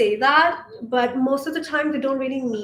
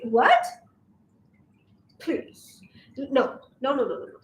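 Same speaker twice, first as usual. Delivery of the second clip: normal talking voice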